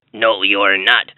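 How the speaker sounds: low, slow troll growl; slow and low like a troll under a bridge; deep troll voice. A narrator's reading. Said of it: "said the terrible troll".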